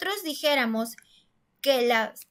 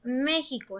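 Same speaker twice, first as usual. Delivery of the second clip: México with the weight on "Mé"; stress on first syllable. Shown as a demonstration. Teacher confirming the correct México, stress on "Mé". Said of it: "la sílaba más fuerte está en la me".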